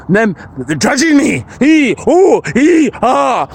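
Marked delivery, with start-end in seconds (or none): 1.6-3.5 wild vocal sounds. Real, not acted. Vocalizing freely in the woods.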